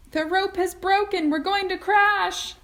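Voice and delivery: high, loud terrified cry; cried out in panic. A narrator's reading. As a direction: shriek that you're falling. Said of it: "Missus T V cried out".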